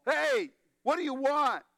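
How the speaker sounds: high, mock holler over a wall; mock yell. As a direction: call out as if to someone beyond a wall. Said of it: "So she yells over the wall".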